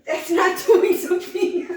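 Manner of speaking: high, slow words broken by laughter; can't hold the laugh in. Real, not acted. Teasing a friend about the Dairy Inn, laughing through the words.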